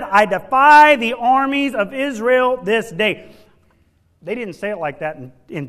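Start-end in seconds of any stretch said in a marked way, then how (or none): 0.0-3.3 Goliath's defiance. Acted.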